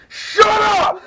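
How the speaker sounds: sudden high, loud yell of "Shut up!"; retold scream. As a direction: snap it out in panic, loud and high. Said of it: "I screamed at Deirdre".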